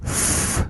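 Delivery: 후 as a soft, airy fff; isolated F sound. Shown as a demonstration. Teacher breaking down the word file, 후 as a bare soft F. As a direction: let air hiss through teeth and lip for 후. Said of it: "소리가 나고요".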